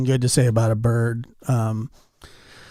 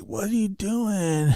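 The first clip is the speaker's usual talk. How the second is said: groggy son; 7 semitones higher, 7 dB quieter, slower than usual. Retold or imitated. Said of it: "he's like".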